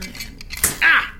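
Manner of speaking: high sudden yelp; pained yelp. Acted. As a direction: yelp from a shock. Gets shocked by wire.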